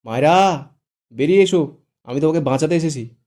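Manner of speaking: loud, high call through the door. Acted. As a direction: call out to Mayera. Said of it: "গলা উঁচিয়ে ডাকল".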